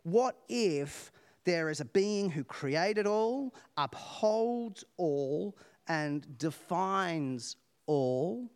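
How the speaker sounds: very slow, each word weighed; slow solemn emphasis. Acted. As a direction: lay it out slowly like a creed. Solemn description of God.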